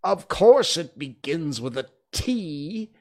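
loud, high, drawn-out rebuke; irritated royal retort; sharp. A narrator's reading. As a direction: rebuke him sharply, stretching the words. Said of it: "said the king sharply".

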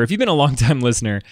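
usual speaking voice

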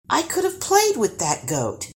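put-on high-pitched girlish protest; complaining. A narrator's reading. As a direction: complain high, then let it fall. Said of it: "complained Guri".

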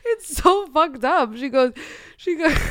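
high, loud, slowed laughing cry; crying with laughter, barely getting words out; near-sobbing laughter; helpless laughter. Real, not acted. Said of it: "I can't even say it without laughing".

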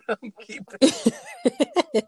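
slow words through laughter; laughing. Real, not acted. Laughs at kept recording.

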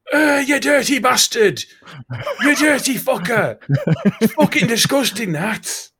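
high, loud mock-outraged yelling; disgusted ranting. Fingers yelling at Bob over the fart, loud disgusted rant. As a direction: bellow it like a man gagging on the smell. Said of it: "he's proper ranting about it".

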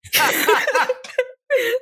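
rough sick laugh; husky laugh. Real, not acted. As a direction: laugh with a raspy cough. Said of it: "it sounds kinda rough".

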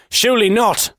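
high, emphatic agreement. Acted. Blagger responds to the fan at the next table, emphatically. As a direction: answer with mock football-fan conviction. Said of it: "I responded emphatically".

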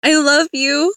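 loud, high, heartfelt call. A narrator's reading. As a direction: call across the water to someone leaving. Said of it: "I called up".

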